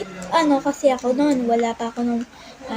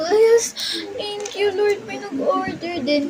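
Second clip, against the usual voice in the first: excited thanks; 7 semitones higher than usual. Retold or imitated. Retelling her first order to viewers, overjoyed and high. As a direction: cheer yes to the sky. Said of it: "Sabi ko".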